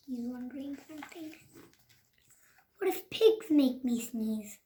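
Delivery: usual speaking voice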